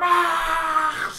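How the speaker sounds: high, loud scream; villain power-up scream. Acted. GM voicing the alien screaming as he grows again. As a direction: let out a hammed-up powering-up roar. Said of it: "he says".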